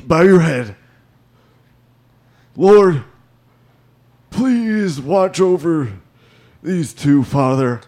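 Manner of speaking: beseech the Lord in mock prayer; deacon's prayer.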